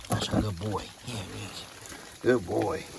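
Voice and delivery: soft praise for an animal; tender praise. Real, not acted. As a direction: murmur fond approval to a pet. Praising the goat softly.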